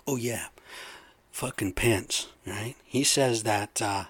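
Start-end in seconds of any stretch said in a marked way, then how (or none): none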